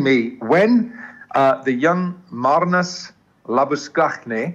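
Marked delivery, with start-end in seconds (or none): 2.3-4.5 Labuschagne said slowly as la-bus-KAKH-nee; throaty kh in Labuschagne, ending in -nee; Afrikaans pronunciation. Shown as a demonstration. Commentator saying it like that for a Free State player, Afrikaans Labuschagne. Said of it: "I'm gonna say it like that".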